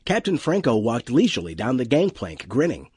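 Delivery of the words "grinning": plain reading voice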